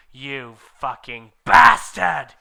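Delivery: slow, very loud bellow; furious yell. Acted. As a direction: roar the insult. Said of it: "yelled Draco at Vampire".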